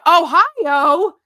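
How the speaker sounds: high, loud questioning holler; sudden playful shout, rising at the end; mock yelling. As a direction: yell it out as an excited inside joke. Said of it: "so you were yelling".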